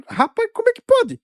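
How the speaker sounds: high-pitched, incredulous exclamation; exasperated disbelief. Real, not acted. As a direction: pitch leaps up in disbelief, then drops. Exclaims in disbelief at fake news.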